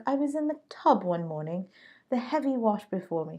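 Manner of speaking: a light English accent on the quote; trying on an English accent for Madam C J Walker. Shown as a demonstration. Podcast host quoting Madam C J Walker in an English accent. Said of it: "maybe she has an English accent".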